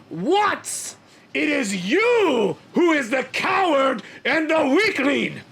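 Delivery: high, loud, slow furious tirade; a warrior throws off his fur and rages at a rival; angry outburst. Acted. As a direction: build from cold scorn to a roaring accusation. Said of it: "You sound upset".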